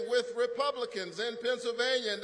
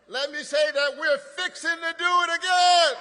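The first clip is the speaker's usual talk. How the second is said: put-on playful Georgia accent, louder and a bit higher; 5 semitones higher, 7 dB louder than usual. A senator hams up a Georgia accent for the crowd. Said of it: "in my best Georgia accent".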